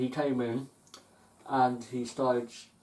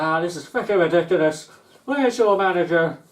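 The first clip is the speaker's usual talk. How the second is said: loud, higher angry demand; an irate customer demanding the manager; fuming customer; 6 semitones higher, 11 dB louder than usual. Retold or imitated. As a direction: bark for the manager, furious.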